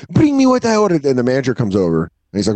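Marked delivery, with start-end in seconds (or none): 0.1-1.0 shrill demand. Retold or imitated. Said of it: "finally she goes".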